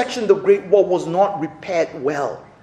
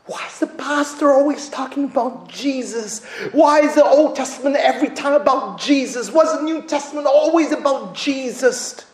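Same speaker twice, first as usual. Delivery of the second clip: mock complaining; 7 semitones higher than usual. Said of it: "think of Jesus as a nuisance".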